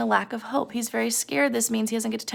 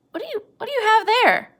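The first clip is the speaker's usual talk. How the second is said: Curly's wife; 12 semitones higher, 3 dB louder than usual. Acted.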